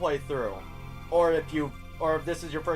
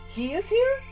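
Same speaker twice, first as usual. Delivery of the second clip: surprised character voice; 4 dB louder than usual. Pretend. Voicing character reading dialogue.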